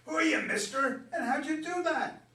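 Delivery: put-on gobsmacked high teenage shout; amazed teenage boy. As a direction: blurt it out, stunned by the hit. Boys ask Joe, amazed.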